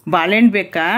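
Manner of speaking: normal talking voice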